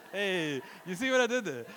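proud preacher laughing at his pun. Real, not acted. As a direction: crow over the pun, laughing.

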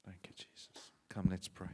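whisper, very soft; whispered prayer. Real, not acted. Pastor whispering to the congregation before prayer.

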